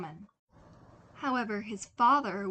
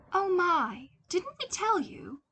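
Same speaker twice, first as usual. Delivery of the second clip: mock surprise; 11 semitones higher, 5 dB louder than usual. Mother feigns surprise.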